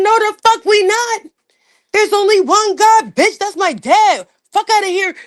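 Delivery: retold blow-up, shouted high; furious yelling. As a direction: go off on her, full rage. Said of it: "I just started spazzing on that bitch".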